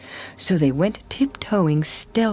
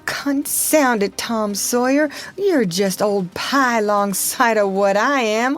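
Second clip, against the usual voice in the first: higher, slow, wailing Lordy; snuffling despair; 6 semitones higher than usual. A narrator's reading. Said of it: "Huckleberry began to snuffle too".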